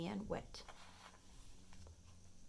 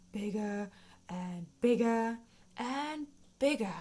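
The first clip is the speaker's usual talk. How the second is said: building louder and bigger as the frog swells; growing louder; 2 semitones higher, 6 dB louder than usual. A narrator's reading. Reading a frog story to children, swelling louder.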